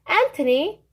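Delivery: bossy Italian grandma laying out the rules; old witch giving orders. Acted. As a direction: lay down the chores like a strict old witch. Strega Nonna addressing Anthony.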